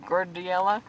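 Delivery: normal talking voice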